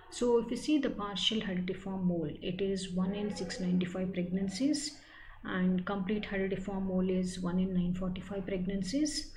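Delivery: ordinary talk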